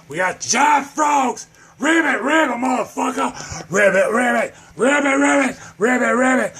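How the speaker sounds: high-pitched hollered frog chant; frantic, punchy shouted ribbits; manic shouting. Acted. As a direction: holler it like a crazed frog hawker.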